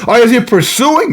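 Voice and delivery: high, taunting prophet's challenge; mock their god with lifted, teasing questions. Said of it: "Elijah mocked them, made fun of them".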